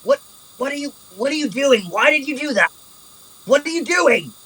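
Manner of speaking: high, frantic questions; alarmed, voice jumped way up; panicked prisoner. Acted. Captive panicking as the rogue rattles chains, frantic.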